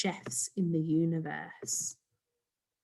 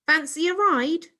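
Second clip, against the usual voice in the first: high, loud eager show-off; eager boy; 9 semitones higher, 5 dB louder than usual. Acted. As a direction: show off your rocket keenly. Boy inviting guests.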